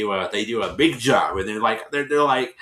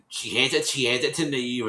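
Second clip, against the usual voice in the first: ordinary talk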